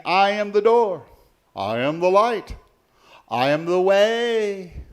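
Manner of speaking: high, loud, ringing declarations; proclaiming as Jesus. Acted. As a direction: announce each title boldly, stretching the last. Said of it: "He went around saying".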